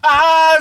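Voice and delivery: high, loud startled gasp of panic; panicked yelp. Retold or imitated. Freaking out over his missing comics, a high yelp. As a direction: let out a sudden panicked "Ah". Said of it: "I started panicking".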